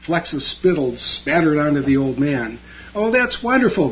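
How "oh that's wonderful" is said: delighted. A narrator's reading. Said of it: "the old man said with delight".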